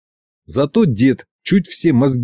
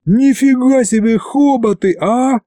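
put-on high slow astonished boy; boy marvels at the mammoth's fur; amazed yell; 9 semitones higher than usual. A narrator's reading. High slow amazed exclaiming. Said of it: "орал я".

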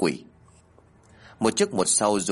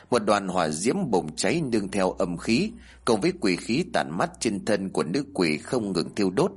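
usual speaking voice

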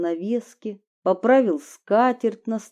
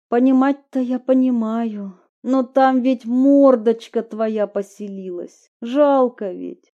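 pleading; 4 semitones higher, 5 dB louder than usual. Acted. Heroine pleading.

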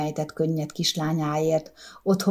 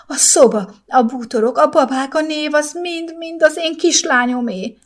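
high, loud shriek; a mother shrieking about her lost little girl; 9 semitones higher, 3 dB louder than usual. A narrator's reading. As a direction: scream it out in despair. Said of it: "sikított a mama".

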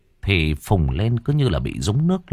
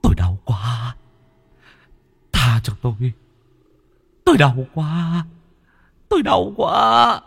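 begging for mercy, rising to an anguished howl; Bính howling in pain; 6 semitones higher than usual. A narrator's reading. Chained ghost begging to be spared, pained cry rising to howl. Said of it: "Ông Bính rú lên".

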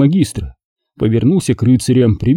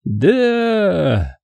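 restrained roar; 7 semitones higher than usual. A narrator's reading. Knights answering their magister, a held-back roar. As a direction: answer as a crowd, stretching the word high. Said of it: "рявкнул зал".

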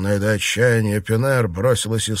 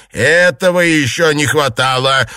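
loud, higher-pitched indignation; raised-voice angry exclamation; indignant shout; 4 semitones higher, 6 dB louder than usual. A narrator's reading. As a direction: cry out in outrage. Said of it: "закричала матушка Дзя".

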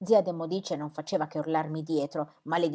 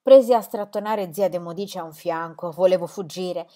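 ordinary delivery, nothing marked

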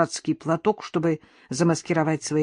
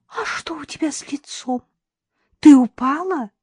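high-pitched sugary concern; honeyed; 9 semitones higher, 4 dB louder than usual. A narrator's reading. Mother-in-law asking about the bruised face, honeyed. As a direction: lift the voice into sweet, innocent questioning. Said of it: "прежним медовым голосом".

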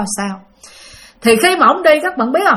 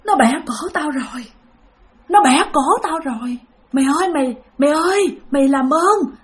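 weak voice; 3 semitones higher than usual. Retold or imitated. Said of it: "với một cái giọng rất là yếu ớt".

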